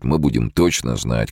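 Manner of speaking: usual speaking voice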